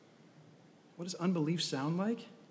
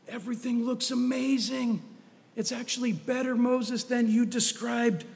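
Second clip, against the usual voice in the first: higher-pitched, upbeat scouting report; enthusiastic report; 6 semitones higher than usual. Acted. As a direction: play up the glowing report to Moses. Spies' report to Moses acted out.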